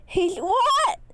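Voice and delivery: high, loud shocked yell; disbelieving yell. A narrator's reading. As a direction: shout it in disbelief. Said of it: "yells in complete disbelief".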